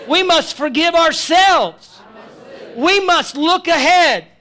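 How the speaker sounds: slow, loud, emphatic lines to repeat; call and response lead. Shown as a demonstration. Preacher leading the congregation in repeating, slow and loud. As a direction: lay it out slow for them to echo. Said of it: "I want you to say this".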